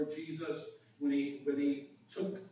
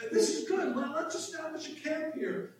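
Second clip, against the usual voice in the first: high, eager acted voice; excited Peter; 10 semitones higher than usual. Preacher as Peter wanting to camp here, excited. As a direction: blurt it out like an overexcited disciple. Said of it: "in his excitement".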